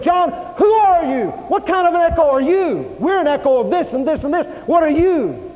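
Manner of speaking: high, loud demanding questions; preacher acting out an insistent crowd; loud challenging interrogation. Crowd interrogating John in retold scene.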